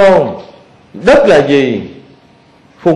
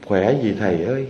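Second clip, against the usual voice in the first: a mildly cold, quiet daughter-in-law's retort; cold reply; 5 semitones lower, 7 dB quieter than usual. Retold or imitated. Monk retelling a daughter-in-law's cold reply, lower and softer. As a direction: voice the daughter-in-law answering coldly, muttered low and soft. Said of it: "Bả trả lời một câu lạnh lùng".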